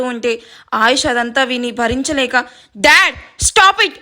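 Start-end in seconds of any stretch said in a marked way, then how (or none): 2.8-3.9 high, drawn-out furious cry; angry shout. Acted. Son shouts at his father who insults his love, furious shout. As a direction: yell at him to stop. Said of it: "కోపంగా చెప్తాడు".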